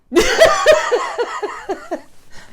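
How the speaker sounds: loud high forced laugh; awkward fake laugh. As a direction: laugh to make them feel awkward. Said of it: "I used to go".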